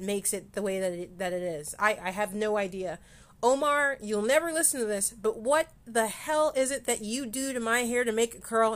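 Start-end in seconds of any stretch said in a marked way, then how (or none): 3.4-8.7 louder, higher call to Omar; loud teasing plea to the barber; playful call-out. Real, not acted. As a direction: shout a fond demand at your barber. Calling out to absent barber.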